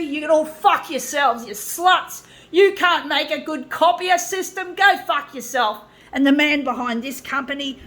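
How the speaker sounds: yelling abuse at the copier makers; furious rant. Real, not acted. Raging at the photocopier industry and its boss, furious shouting. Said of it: "I so get so angry".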